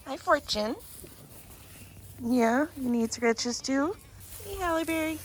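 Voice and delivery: high, soft coo; sweet talk to each goat. Real, not acted. Greeting the goats.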